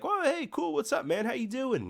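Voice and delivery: high, breezy hello to a newcomer; friendly greeting. Retold or imitated. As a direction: greet the new guy like old pals. Neighbours greeting the new kid.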